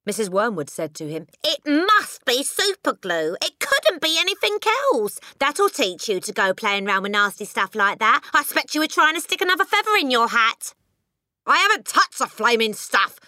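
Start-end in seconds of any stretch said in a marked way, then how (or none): 1.4-10.7 high, loud nagging wife; scolding wife. A narrator's reading. 11.4-13.2 high, loud indignant shout; Mister Wormwood shouting. A narrator's reading. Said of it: "Mister Wormwood shouted".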